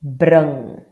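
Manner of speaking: "Brung" called out loud; model word. Shown as a demonstration. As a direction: announce "Brung" clearly. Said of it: "slightly different pronunciation".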